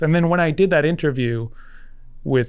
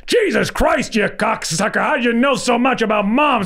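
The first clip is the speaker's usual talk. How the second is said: put-on pitched way up, incredulous ranting old showman; George Schlatter; 9 semitones higher than usual. Producer phoning a young writer, high incredulous rant. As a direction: ham up an exasperated old Hollywood producer. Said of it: "he phones me, he goes".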